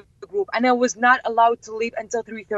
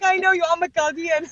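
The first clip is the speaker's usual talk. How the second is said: high, laughing imitation; laughing reply; 7 semitones higher than usual. Sign holder recognising Vivian, high laughing voice. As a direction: say it through a giggle.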